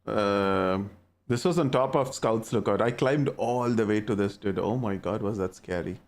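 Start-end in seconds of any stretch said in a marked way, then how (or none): none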